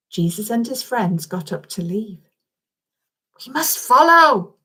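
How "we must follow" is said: put-on urging child. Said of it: "said Alia".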